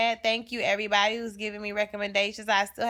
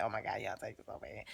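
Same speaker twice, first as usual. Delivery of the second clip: muttered aside; 11 semitones lower, 14 dB quieter, faster than usual. Real, not acted. Teasing aside about men.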